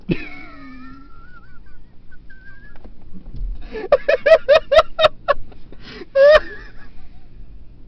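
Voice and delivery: helpless high-pitched laughter; long high laugh. Real, not acted. Teller laughing at own joke, high and long. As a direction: crack up at your own punchline.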